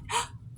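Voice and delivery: sharp high gasp of realization; excited gasp. Real, not acted. Vlogger realizing she can carve both sides, excited gasp. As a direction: gasp as the idea lands. Realizing two-sided stamps possible.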